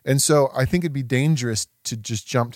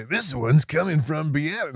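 smooth radio announcer read; 2 semitones higher than usual. Retold or imitated. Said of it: "It kinda sound like a, a radio D J".

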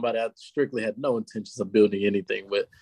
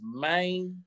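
drawn-out Memphis "man"; soft mock Memphis twang on "man"; Memphis accent; 6 semitones higher, 6 dB quieter than usual. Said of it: "Any girl that says".

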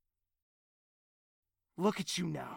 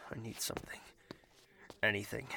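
whispering; 6 semitones lower, 8 dB quieter, much faster than usual. Acted. Inner pain.

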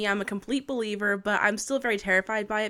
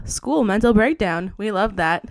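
usual speaking voice

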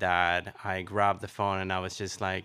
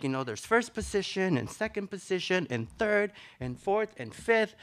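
put-on eager ballet girl; 9 semitones higher than usual. Ballet teacher girl explaining positions.

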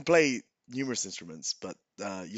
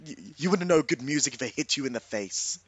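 raised-voice comeback, quickening; shouted retort; 5 semitones higher, 4 dB louder, faster than usual. Acted. Bard answering hecklers from the stage, shouted retort. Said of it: "I shout to them".

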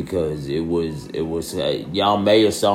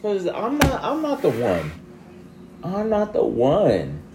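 much higher, exasperated sassy voice; high mock exasperation; 13 semitones higher than usual. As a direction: scold them in a high put-on voice. High mocking retort to commenter.

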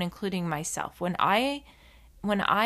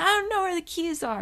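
groggy cranky sister; 10 semitones higher, faster than usual. Retold or imitated. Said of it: "And she's like".

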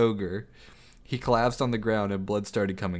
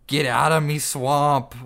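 loud, slow angry ogre line; snapped; 5 semitones higher, 7 dB louder than usual. Acted. Grieving Shrek snaps at the soldiers, angry and loud. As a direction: bark it at the soldiers. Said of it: "Then he snapped".

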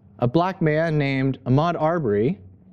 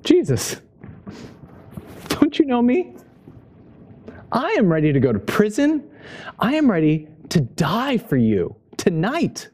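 higher-pitched, incredulous pledge of loyalty; protesting Peter; 5 semitones higher than usual. Retold or imitated. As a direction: play an earnest disciple protesting, pitched up. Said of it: "And Peter says".

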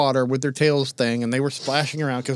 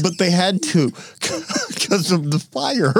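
slow, broken up by real laughter; laughing; 3 semitones higher, slower than usual. Real, not acted. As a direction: struggle to speak through giggles. Co-host breaking up laughing.

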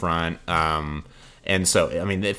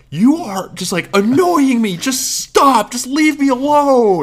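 high, exasperated raised voice with stretched words; retold yell; 6 dB louder, slower than usual. Telling off the girl who keeps rubbing him, high exasperated yell. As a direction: re-enact yelling at someone to back off. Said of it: "yelled at her".

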